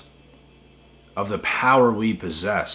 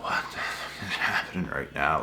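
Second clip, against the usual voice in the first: stunned whisper; 4 semitones lower, 6 dB quieter than usual. Retold or imitated. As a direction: breathe it out in disbelief. Stunned reaction to the crying.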